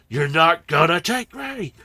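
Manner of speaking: shouted, with the voice wavering; a cry that starts to crack; wavering defiant shout. Acted. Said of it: "he sounds different".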